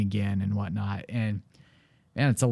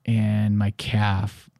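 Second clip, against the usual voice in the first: ordinary talk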